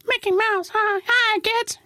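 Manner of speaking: very high squeaky cartoon mouse; Mickey Mouse voice. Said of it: "I was doing the whole".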